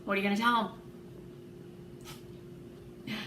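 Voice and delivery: ordinary delivery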